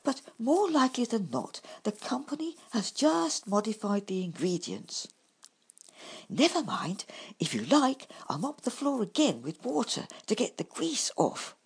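a hint of high, gentle badger; lightly voiced kindly badger. A narrator's reading. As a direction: offer help calmly. Bumble voiced.

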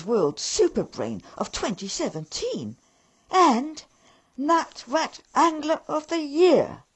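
puffed-up boasting, outraged; pompous indignation. Acted. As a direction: Lord Grumpkin lecturing the elves loudly on his status. Rat lord indignant speech.

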